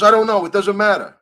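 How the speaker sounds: high, loud, dismissive whine; hammed-up careless official; mocking Lenny. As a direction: squeal it like a brush-off. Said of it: "He goes".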